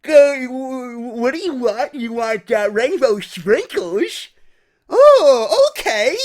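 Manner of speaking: high, halting slow goofy voice; dim teenage server. Acted. Young server slowly taking an ice cream order, goofy and halting. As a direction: stammer slowly, with sudden jumps in pitch. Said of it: "She's just out of high school".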